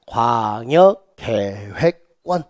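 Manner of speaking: slow classroom recitation; drilled term. Shown as a demonstration. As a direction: stretch each syllable so students can chant along. Teacher drills term for repetition.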